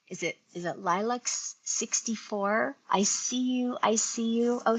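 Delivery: normal talking voice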